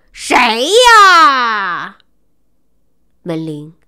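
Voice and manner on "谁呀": loud shout from bed, fed up. Acted. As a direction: yell "who is it" at the door. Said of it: "大叫道". (不耐烦地大喊)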